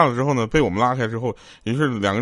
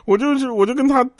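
high whiny voice, much higher, a comic put-on sob story; mock crying complaint; 11 semitones higher, 3 dB louder than usual. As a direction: play the cheated husband whining high and tearful, hammed-up. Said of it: "来电话哭了都".

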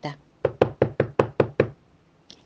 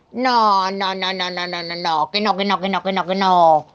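stubborn refusal. Acted.

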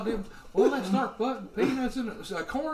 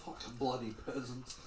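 groaned under breath; 7 semitones lower, 13 dB quieter than usual. Acted. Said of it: "groan to myself".

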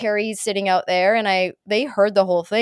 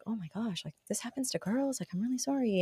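put-on hushed, much softer apologetic boy; son's sympathy; 14 dB quieter than usual. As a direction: murmur it like a sorry kid. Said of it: "one of them had already been like".

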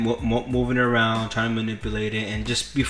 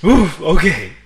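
hiccup giggles; 4 semitones higher, 4 dB louder than usual. Real, not acted. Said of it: "I can't stop laughing".

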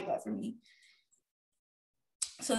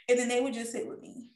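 ordinary delivery

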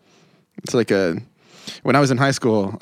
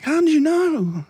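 very high, drawn-out astonished squeal; exaggerated amazement at being found out; mock amazement; slower than usual. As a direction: stretch it out high, hammed-up. Voicing amazed stranger from Virginia.

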